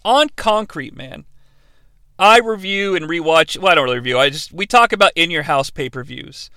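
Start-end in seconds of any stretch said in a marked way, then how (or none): none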